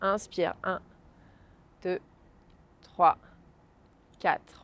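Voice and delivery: soft, slow, spaced counting; guided breathing count. Acted. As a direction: count each breath calmly and slowly. Counts breaths slowly, softly. (décompte lent et doux de respiration)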